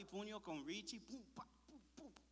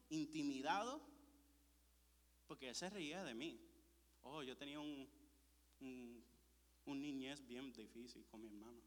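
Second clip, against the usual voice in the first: ordinary talk, nothing marked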